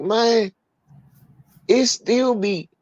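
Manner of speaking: high-pitched incredulous retelling; retold disbelief. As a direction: act out being baffled by it. Retold incredulous question to coworker.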